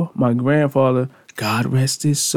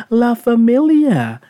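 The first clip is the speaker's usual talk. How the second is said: high, playful La Familia with Spanish flair; 10 semitones higher, 4 dB louder, slower than usual. Real, not acted.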